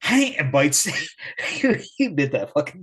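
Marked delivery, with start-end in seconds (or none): 0.0-0.3 sudden high loud hey; mock yell. Friend reenacting Adam grabbing the foot, high mock yell. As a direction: yell it right before the bite. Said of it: "He goes".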